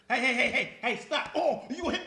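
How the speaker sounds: loud, high "hey, hey, stop"; mock calling out. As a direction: shout to break up a fight, laughing. Acting out breaking up a fight.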